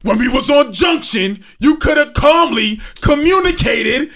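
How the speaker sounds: loud, high, slow teasing call-out; mock shouting tease. Friend loudly teasing about the Junction fight. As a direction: bellow it to rib your friend.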